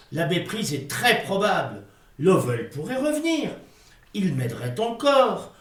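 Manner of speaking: ordinary talk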